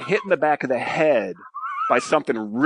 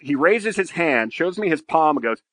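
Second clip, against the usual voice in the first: normal talking voice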